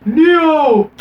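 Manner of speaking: loud, high hammed-up cry of a name; imitated dramatic yell, drawn out; mock shout. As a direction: bellow it like a bad action-movie hero. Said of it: "as not Morpheus is shouting".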